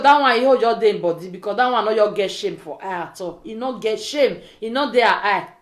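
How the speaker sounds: ordinary delivery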